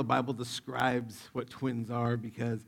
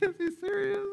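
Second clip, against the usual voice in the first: nervous laugh. Retold or imitated. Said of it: "you give this nervous laugh".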